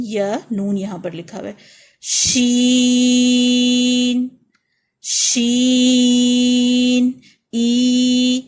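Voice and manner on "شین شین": شین slow and loud with a long ee; stretched letter name. Shown as a demonstration. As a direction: draw out شین at full voice. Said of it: "ای کی آواز آئے گی".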